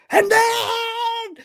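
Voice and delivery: excited drawn-out yell; hype shout. Real, not acted. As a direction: stretch it out like a hype man.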